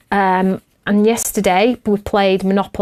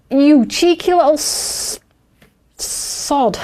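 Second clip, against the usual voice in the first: slow, higher mock scold; mock telling-off; 6 semitones higher, slower than usual. Mum to son after his clever answer, mock scolding. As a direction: playfully scold the cheeky boy. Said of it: "I was like".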